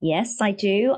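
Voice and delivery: usual speaking voice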